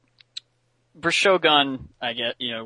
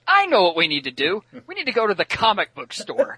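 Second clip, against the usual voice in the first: high, eager cartoon sidekick; excited Beast Boy; 8 semitones higher, 3 dB louder than usual. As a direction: pitch it up like a bright idea. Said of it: "as he does, just like".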